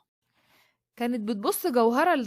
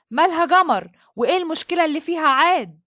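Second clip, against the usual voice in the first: louder and higher, an angry demand; 4 semitones higher, 7 dB louder than usual. Acted. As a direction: snap the question at the guard in rage. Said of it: "بغضب جدًا".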